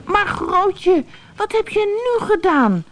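put-on high child's voice crying out in dismay. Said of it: "riep Brammetje Braam". (hoge kinderstem, ontsteld roepend)

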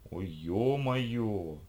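drawn-out wail of dismay; oh-no moment, very slow; dismayed groan. Acted. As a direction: stretch the words right out, appalled. Lisha clutches her head in dismay.

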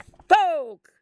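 barked order to fire. Acted. The captain ordering his crew to fire on the children. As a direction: fire the cannon now.

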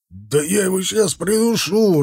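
high-pitched, straining threat; furious threat. A narrator's reading. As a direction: lunge into it as if held back. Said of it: "рвался к нему Захар".

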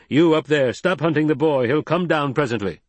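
high, loud call to someone far above; calling up the cliff. Acted. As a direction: shout up the rock face. Stilgar calls up from basin.

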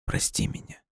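very soft whispered apology; hushed, voiceless plea; quiet whisper. A narrator's reading. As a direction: whisper it, barely audible. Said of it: "и тихо прошептала".

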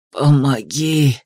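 low, drawn-out croaked plea; hoarse croak. A narrator's reading. Madman begging a passer-by for help, hoarse croak. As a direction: croak it out slowly, well below your voice. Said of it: "прохрипел мужчина".

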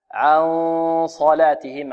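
slow "عن صلاتهم", the n held heavy into the Sad; nasal n before Sad. Shown as a demonstration. Teacher showing how the Noon is affected, slow held nasal. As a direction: hold the hum of "عن" into a heavy S. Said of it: "هكذا".

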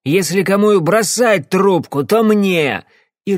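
high, loud, slow furious bark; barked threat. Acted. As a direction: snarl it at her, drunk and enraged. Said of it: "рявкнул".

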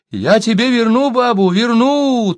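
high, loud, pleading promise; storyteller voicing a thin-voiced coward; thin high exclamation. A narrator's reading. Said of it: "тонко воскликнул Сибун".

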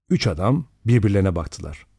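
ordinary delivery

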